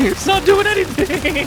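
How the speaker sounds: high, loud laughing yell; laughing shout. Real, not acted. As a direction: laugh it out in disbelief. Laughing frustration.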